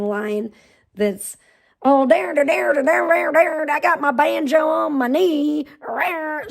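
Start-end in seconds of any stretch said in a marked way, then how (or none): 1.8-6.5 higher, louder twangy sing-song; mock hillbilly. Streamer mocking a hillbilly voice, higher hammed-up twang. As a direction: ham up a backwoods banjo yokel. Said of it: "backwoods hillbilly voice".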